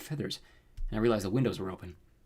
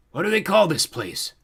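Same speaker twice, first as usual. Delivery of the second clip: slow, loud, raised commanding voice; menacing fiery lord; 7 semitones higher, 7 dB louder, slower than usual. A narrator's reading. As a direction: demand it like a villain overlord. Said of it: "the man asked".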